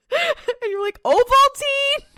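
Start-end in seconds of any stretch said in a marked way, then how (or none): 1.0-2.0 very high, loud, drawn-out question; incredulous echo. Acted. Viewer reacting to the villain's odd line, incredulous. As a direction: repeat it in exaggerated disbelief.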